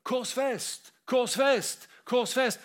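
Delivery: insistent retold shout; crowd crying out. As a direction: call out the demand like an angry crowd. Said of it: "Og så roper de ut".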